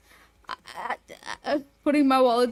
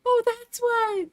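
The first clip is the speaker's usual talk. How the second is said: high-pitched drawn-out repeat; high squeaky voice; 10 semitones higher, slower than usual. Acted. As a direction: squeak it out slowly.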